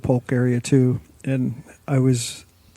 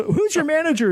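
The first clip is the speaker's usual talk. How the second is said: demanding manager; 14 semitones higher than usual. Retold or imitated. Offended customer demands manager.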